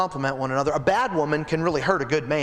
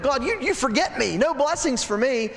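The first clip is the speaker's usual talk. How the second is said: raised, pleading voice of a man feeling forgotten; mock complaint to God; 7 semitones higher than usual. Mordecai complaining to God, high and pleading. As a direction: cry out that God forgot you.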